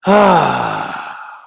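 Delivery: loud, long exhale; heavy sigh. Real, not acted. Streamer before opening the article, heavy sigh. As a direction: let out a big breathy sigh.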